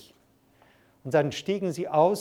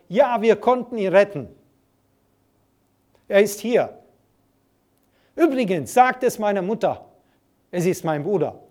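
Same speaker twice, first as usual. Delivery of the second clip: louder, higher shout over the surf; calling back; 4 semitones higher, 6 dB louder than usual. Retold or imitated. Rescued boy calling back to the shore, loud and high. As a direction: call back joyfully from the boat. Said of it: "rief zurück".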